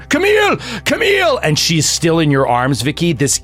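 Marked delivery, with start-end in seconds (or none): 0.0-1.4 high yelled name; father yelling for his wife; calling out. Acted. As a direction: shout for her across the house. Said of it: "he starts going".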